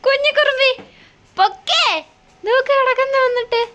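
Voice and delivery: high, loud mock scolding; playful scolding. Real, not acted. Playfully scolding a dawdling baby. (കുറുമ്പിയെ ഉച്ചത്തിൽ കളിയായി ശകാരിക്കുന്നു)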